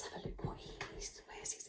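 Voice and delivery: hushed secret whisper. Real, not acted. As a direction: whisper as if peeking. Peeking for a puppet.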